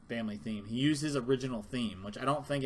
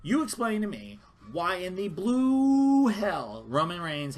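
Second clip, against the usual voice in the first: slow, louder, raised angry challenge; indignant demand; 6 semitones higher, 5 dB louder, slower than usual. Real, not acted. Fan demanding viewers explain Reigns, angry and raised. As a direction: demand an answer, fed up.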